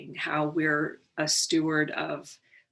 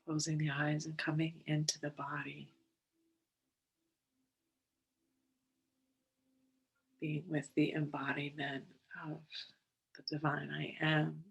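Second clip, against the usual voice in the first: unhurried, calm and measured, settling them in; guided meditation; 4 dB quieter than usual. Real, not acted. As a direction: guide listeners gently into the breath, leaving space between phrases.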